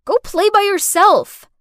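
loud, high-pitched angry order; irritated shout. A narrator's reading. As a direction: shout the child away. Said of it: "I shouted".

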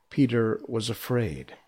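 ordinary delivery

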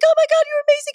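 very high, loud squeal; gushing fan. Retold or imitated. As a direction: gush like a thrilled fan. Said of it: "they're like".

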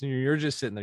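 usual speaking voice

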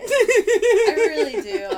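high, slow giggling admission; laughing. Real, not acted. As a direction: laugh through the words.

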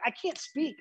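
high, airless, strained words; strained gasping voice. Retold or imitated. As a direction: force the words out with burning lungs. Gassed recruit can't speak.

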